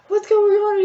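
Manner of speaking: loud, high worried call; alarmed shout. Retold or imitated. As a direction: yell it across the street. Bystanders shouting at crying girls.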